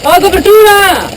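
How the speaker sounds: loud drawn-out shout for cover; combat callout. Real, not acted. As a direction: yell slowly for covering fire. Calling for cover under fire.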